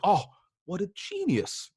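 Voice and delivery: high, slow, sing-song mock admiration; mock sarcastic praise. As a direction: mimic the colleagues' fake gushing, dragging it out high. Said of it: "and they'd be like".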